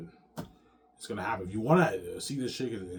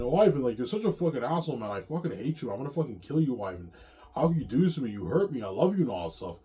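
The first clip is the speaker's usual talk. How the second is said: loud, higher-pitched acted reproach; hurt, angry outburst; 5 semitones higher, 7 dB louder than usual. V J berating Ivan for hurting her, angry and hurt. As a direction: play a wounded lover lashing out loudly.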